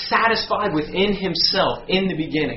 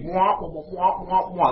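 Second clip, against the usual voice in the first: higher, wordless droning adult; Charlie Brown's teacher; 3 semitones higher than usual. Retold or imitated. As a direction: imitate a teacher's meaningless wah-wah drone. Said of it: "He sounded like Charlie Brown's teacher".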